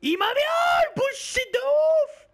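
high furious yell; raging gamer. Real, not acted. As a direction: scream at the screen in rage. Killed, yelling at the game.

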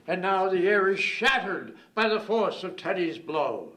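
loud, ringing burst of verse; triumphant poetic climax; climactic declamation. Acted. Climax of the recited poem.

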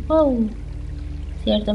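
ordinary delivery

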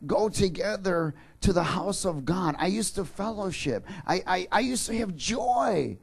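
ache over the lost joy, stammering; psalmist's longing. Acted. Preacher voicing the psalmist's grief, rising to a cry. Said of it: "he's saying".

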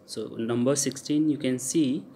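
ordinary delivery

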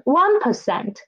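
usual speaking voice

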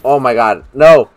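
loud rising cry of dismay; mock outrage. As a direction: yell it like a ruined gamer. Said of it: "There's a saying that goes".

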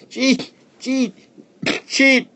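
very high bird cries; chattering birds. A narrator's reading. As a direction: chatter like angry sparrows. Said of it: "they chattered at Peony".

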